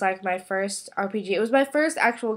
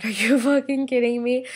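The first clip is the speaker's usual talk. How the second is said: laughing disbelief; 4 semitones higher, 4 dB louder than usual. Retold or imitated. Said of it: "And we were like".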